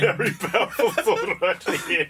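mock-profound, high and laughing; mock deep-thinker. Shown as a demonstration. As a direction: ham it up like a proud big-brain quote. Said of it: "Big brain".

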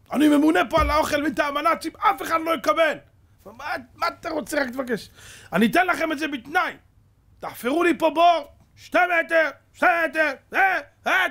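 loud mock-authoritative demands; commanding shout. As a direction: order them around like the Nazis' food chief. Hero commands partisans as food boss.

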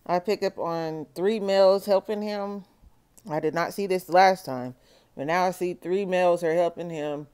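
ordinary talk

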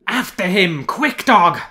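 shrill, very loud hunting cry; shouting. A narrator's reading. Outram urging his dog in the chase, high shout. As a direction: drive the hound on, high and hard. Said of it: "were shouting and gesticulating".

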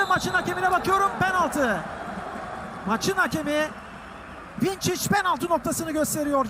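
excited commentator. Acted. Penalty call, excited commentary.

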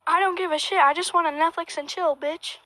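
normal talking voice

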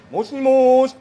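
大きく伸ばして呼びかける; 受話器に向かって張り上げて; 電話口の呼びかけ. Acted. 電話をつなぐ呼びかけ. (電話に向かって大きく「もしもーし」)

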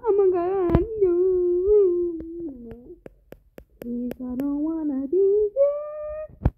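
high, loud frightened begging; panicked plea, slowing down at the end; scared pleading. Real, not acted. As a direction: beg to be let out, terrified. Frightened pleading in horror game.

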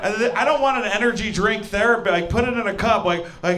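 ordinary talk, nothing marked

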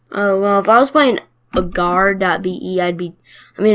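usual speaking voice

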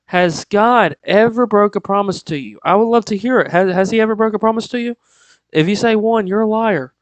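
rising preacher's challenge to the listeners; fired-up preaching. Real, not acted. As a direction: preach it out to the congregation. Preaching at full force.

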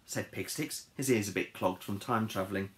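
ordinary delivery, nothing marked

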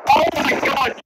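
high shocked exclamation; startled cry. Real, not acted. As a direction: yelp it in sudden fright. Launched into the air.

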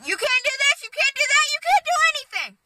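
mocking strict parents. Kid mocking her stepsister's strict parents in a high nagging voice. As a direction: mimic the bossy parents in a squeaky high pitch. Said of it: "They're like".

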